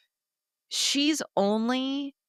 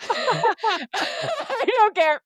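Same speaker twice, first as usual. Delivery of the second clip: laughing; 12 semitones higher, 4 dB louder, much slower than usual. Real, not acted. Laughing fit over crush.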